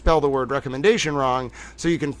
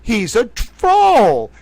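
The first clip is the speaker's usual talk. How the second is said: slow, drawn-out teasing accusation; a hint of a chat viewer calling out; mock accuser; 5 dB louder, slower than usual. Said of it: "They're gonna be like".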